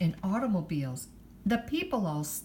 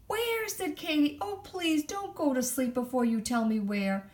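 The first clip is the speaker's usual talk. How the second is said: pleading high kangaroo voice; 8 semitones higher than usual. Acted. Katie begs the owl.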